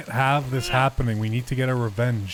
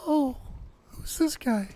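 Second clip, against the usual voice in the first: high falsetto lady voice, a man in disguise; fake lady voice; 12 semitones higher, 5 dB quieter, slower than usual. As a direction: squeeze it up into a fake woman's voice. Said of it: "Disguise your voice like a lady?".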